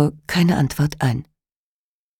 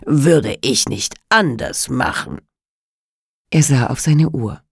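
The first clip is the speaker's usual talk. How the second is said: ordinary delivery, nothing marked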